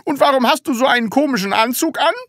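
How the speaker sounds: high, loud, very fast question; interrogating schoolmaster. Acted. Teacher questioning pupil about odd suit, testy and demanding.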